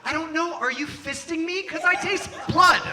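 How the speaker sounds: high-pitched, strained mock protest; high and distressed, drawing out the ending; pained protest. Acted pained reply to therapist.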